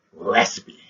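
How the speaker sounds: loud rasping "raspy". Shown as a demonstration. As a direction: rasp it out hard. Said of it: "it can sound all sorts of different ways".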